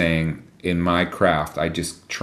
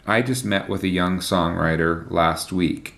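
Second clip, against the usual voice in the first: ordinary talk, nothing marked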